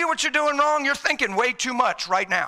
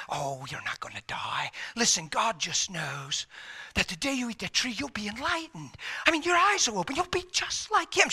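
hushed, insinuating tempter, rising toward the end; tempter's voice; 3 semitones lower, 7 dB quieter than usual. Acted. Serpent tempting Eve to eat from the tree, hushed coaxing. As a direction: coax softly, as if sharing a secret. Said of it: "suggested another tone, another thought".

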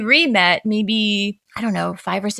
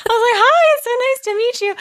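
much higher, louder gushing; starstruck gushing; 6 dB louder than usual. Retold or imitated. Fan meeting [name], gushing high and bright. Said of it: "I was like".

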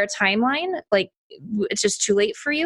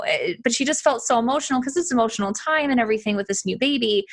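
ordinary talk

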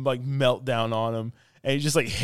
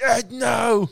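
higher, louder "Ed, no!"; a hammed-up cry of alarm; mock cry; 10 semitones higher, 6 dB louder than usual. Acting the film's cry.

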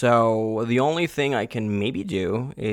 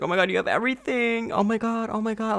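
much higher, fast gushing squeal; squealing friend; 11 semitones higher, faster than usual. Retold or imitated. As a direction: squeal over everything like an excited friend. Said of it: "she was like".

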